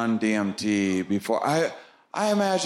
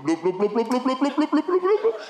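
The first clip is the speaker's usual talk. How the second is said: rising bloop sounds; 12 semitones higher, faster than usual. Retold or imitated. Comedian voicing the tunnel ride, rising bloops. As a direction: chirp the bloops ever higher as you zoom. Imitating rushing through tunnel.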